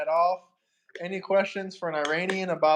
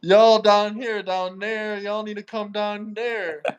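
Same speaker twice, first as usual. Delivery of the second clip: Southern accent; 4 semitones higher, slower than usual. Shown as a demonstration. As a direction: put on a stretched, higher-pitched Southern twang. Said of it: "What's your best Southern accent".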